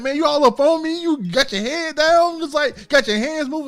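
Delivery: high, loud complaining voice; loud complaining friend. Retold or imitated. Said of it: "he was like".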